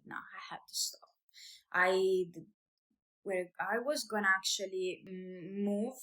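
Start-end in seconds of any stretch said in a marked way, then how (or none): 0.1-1.1 a hushed, unvoiced whisper to herself; whispered self-talk. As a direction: breathe the words out like a private decision. Retelling a breaking point.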